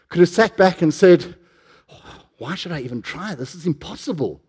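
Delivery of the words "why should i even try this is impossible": very soft, defeated murmur. Acted. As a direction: give up under your breath as the imagined man. Imagined man giving up.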